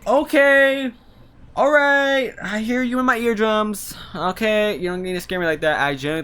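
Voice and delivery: high, loud, fast flustered reaction; startled. Real, not acted. A player spooked by a game voice in their ears, high loud startled babble. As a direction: shake off a real fright, rushing the words. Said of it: "I actually got a little scared".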